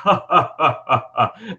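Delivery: measured mock laugh; a lightly voiced guardian's chuckle; angel laughs. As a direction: laugh it off like a knowing old angel. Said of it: "he, like, laughs".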